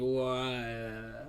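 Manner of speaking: "Wha-" stuck and stretched out, soft; struggling to pronounce. Retold or imitated. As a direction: imitate someone failing to get past "Wha-". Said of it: "every time they try, they're just like".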